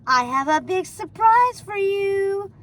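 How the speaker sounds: high, slow sing-song voice. A narrator's reading. Gothel to Rapunzel, in a sing-song voice. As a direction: croon it like a stage actor. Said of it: "strange singing voice".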